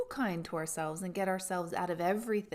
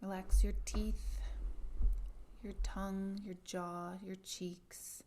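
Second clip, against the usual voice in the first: guided meditation; 8 dB quieter than usual. Acted.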